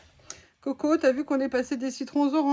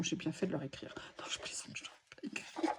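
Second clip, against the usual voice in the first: conspiratorial whisper, much softer; whispered aside; 6 semitones lower, 13 dB quieter, faster than usual. Real, not acted. Joking to viewers she wrote to them, whispered aside. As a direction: let them in on the joke in a whisper.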